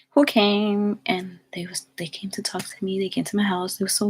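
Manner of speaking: hushed reveal, breathed not voiced; whisper. Real, not acted. Building up who came to her job, in a teasing whisper. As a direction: lean in and whisper the secret.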